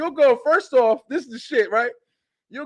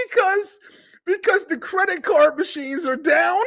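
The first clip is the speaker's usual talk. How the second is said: higher, laughing mock of the worker; laughing through the quote; 10 semitones higher than usual. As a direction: crack up while quoting him. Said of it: "He said".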